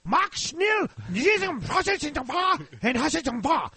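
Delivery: loud fake German halftime rant; mock German accent. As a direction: bark at the players like a cartoon German manager. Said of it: "Become the boss".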